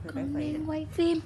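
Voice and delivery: calling to mom in Vietnamese; turned away, answering mom; turning away from phone. Real, not acted. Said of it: "that was my mom".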